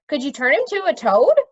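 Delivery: put-on boy prince. A narrator's reading. Eager boy asks.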